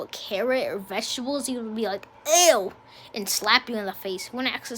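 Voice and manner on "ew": loud, higher-pitched "ew"; imitating a grossed-out kid; mimicked disgust. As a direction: recoil in exaggerated disgust. Mimics friend's disgust at vegetables.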